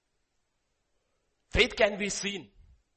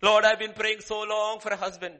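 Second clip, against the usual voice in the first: lightly voiced pleading prayer; 4 semitones higher, 7 dB louder than usual. Mock prayer of congregant.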